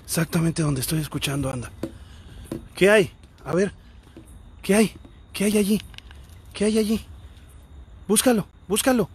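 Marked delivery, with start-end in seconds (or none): 2.7-9.1 higher-pitched, raised-voice questioning; calling out. Real, not acted. Investigator urging a dog to search, calling out. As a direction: call out into the dark, pitched up.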